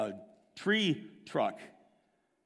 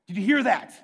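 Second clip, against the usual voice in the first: alarmed call; 4 semitones higher, 6 dB louder, faster than usual. Retold or imitated. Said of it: "And he's not joking".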